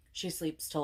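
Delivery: ordinary talk, nothing marked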